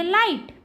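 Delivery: लाइट with the diphthong आइ. Shown as a demonstration. Teacher showing learners the I sound, लाइट with आइ. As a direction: glide the vowel in लाइट from आ to इ. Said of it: "आई के रूप में होता है".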